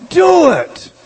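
sudden loud high command; emphatic shout. Acted. Preacher demands the church act now, sharp loud shout. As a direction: bark it out as a decisive order.

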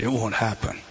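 usual speaking voice

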